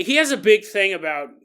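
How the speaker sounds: usual speaking voice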